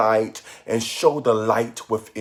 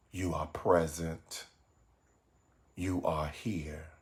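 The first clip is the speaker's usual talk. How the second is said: softer, measured meditation voice with long pauses; guided meditation; 3 semitones lower, 7 dB quieter than usual. Acted. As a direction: guide listeners gently inward, phrase by phrase.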